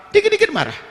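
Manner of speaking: hammed-up nagging; mock angry shrill voice. Shown as a demonstration. (suara omelan yang dibuat-buat, jauh lebih tinggi)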